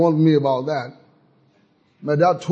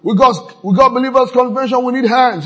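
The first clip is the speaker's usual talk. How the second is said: high loud call for helpers; preacher playing a church worker calling for help; 9 semitones higher, 6 dB louder than usual. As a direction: call out for hands, hammed up. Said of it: "Someone we can call upon".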